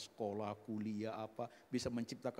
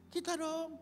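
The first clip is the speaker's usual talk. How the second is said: high-pitched, no shouting; high, contained insistence; high repeat; 14 semitones higher than usual. Real, not acted. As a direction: pitch it high, keep the volume down. Same words repeated at normal level.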